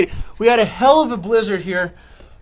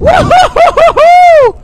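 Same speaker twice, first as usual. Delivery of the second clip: excited whoop; 10 dB louder, slower than usual. Real, not acted.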